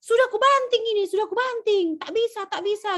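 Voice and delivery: very high, loud, fast protest; son shouting back. Retold or imitated. Son answering his father about the steering, high fast shout. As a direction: yell back that it won't turn. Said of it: "Yang anaknya jawab bilang".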